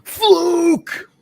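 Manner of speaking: high, loud, strained shout of one word; imitating Roode's yell. Roode shouting at Aries in the ring, imitated furious yell. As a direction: bark it at his face like a sore loser. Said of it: "he just goes".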